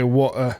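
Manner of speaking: "what, uh" as water with the T swallowed; dropped T. Shown as a demonstration. Asking which UK accent drops T's, "what, uh" with T swallowed. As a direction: break "what, uh" with a glottal stop for water's T. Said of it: "deletes T's out of things".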